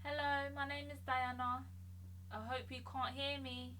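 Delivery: distant, faint voice across the room; far from the mic. Shown as a demonstration. Talking from the room's corner to test background pickup, far off. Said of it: "I'm gonna stand in the corner of the room and move around".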